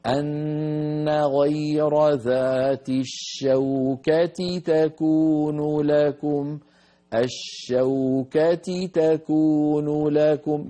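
"الشوكة تكون" بإظهار التاءين ببطء شديد; أعطِ كل تاء حقها دون دمج; نطق مجوّد صحيح. Shown as a demonstration. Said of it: "هكذا يجب أن يكون". (تاء مكسورة ثم تاء مفتوحة واضحتان ببطء)

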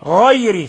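غير with a full, thickened gh; heavy ghayn. Shown as a demonstration. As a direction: make the gh in غير heavy and emphatic. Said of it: "فخم الغين".

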